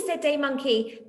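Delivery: lively storybook reading voice; reading to children. A narrator's reading. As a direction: read it out like a picture book for little ones. Reading a picture book aloud to children.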